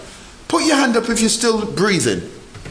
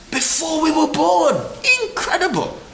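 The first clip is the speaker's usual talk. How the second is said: high excited exclamation; 11 semitones higher than usual. Real, not acted. Marvelling at being chosen.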